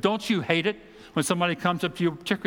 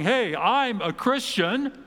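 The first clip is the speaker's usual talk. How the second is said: higher, slow, showy boast; mock swagger; 7 semitones higher, slower than usual. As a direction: strut the words like a proud believer. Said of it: "We don't do this with a swagger".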